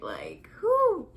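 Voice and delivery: high loud pained whoo. Real, not acted. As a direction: let out a sharp whoo. Pain remembered.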